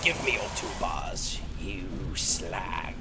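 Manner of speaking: low, soft mock threat, sinking to a whisper; half-whispered shakedown; mock demand. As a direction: growl a demand for the bars, then whisper it. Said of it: "and I'm like".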